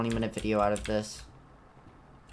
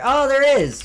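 excited; 12 semitones higher, 11 dB louder than usual. Real, not acted. Spots the item in game.

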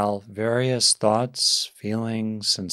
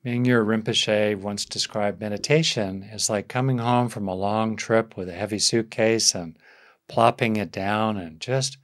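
ordinary talk

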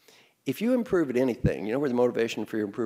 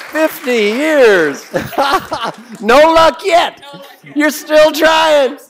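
slow, high and loud, laughing through it; laughing tease; 13 semitones higher, 13 dB louder, much slower than usual. Pretend. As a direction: laugh at him while teasing. Teasing audience member, laughing.

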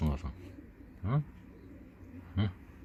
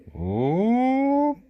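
high, loud hop; playful shout; 6 dB louder than usual. Pretend. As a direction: cheer as you lift it. Lifting the baby animal up.

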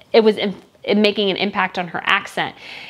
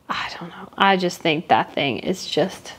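whispered aside; 3 semitones lower, 5 dB quieter than usual. Real, not acted. Vlogger whispering to viewers that the dress is ugly. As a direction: whisper it like a guilty secret.

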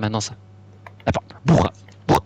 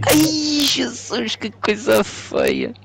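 mock lament; 12 semitones higher, much slower than usual. Lamenting a bad play.

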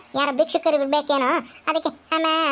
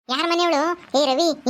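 usual speaking voice

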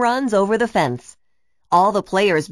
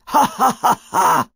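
gloating evil laugh; villain laughing at Zeke in the smokescreen; villain chuckle; 4 dB louder than usual. A narrator's reading. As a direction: cackle from inside the smoke. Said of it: "chuckled Hookah".

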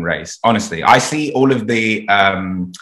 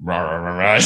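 dismissive mock muttering; mock grumbling; 3 semitones lower than usual. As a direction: imitate people grumbling on and on. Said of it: "they didn't grow up in and go".

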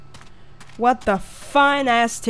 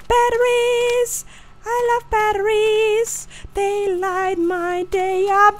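1 gushing delight; 8 semitones higher than usual. Real, not acted. Excited about finding batteries.

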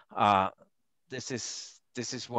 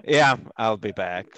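put-on Austrian accent; 8 dB louder than usual. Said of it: "with my Austrian accent".

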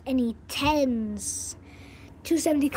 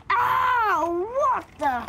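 startled cry; 6 semitones higher, much slower than usual. Real, not acted. Startled by something appearing.

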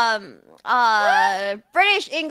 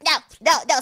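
ordinary talk

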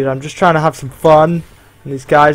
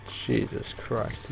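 breathless, slow whisper; whispered curse; 3 semitones lower, 16 dB quieter, much slower than usual. Real, not acted. Gamer reacting to a sudden scare, whispered curse. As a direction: breathe it out under your breath. Muttered reaction mid-game.

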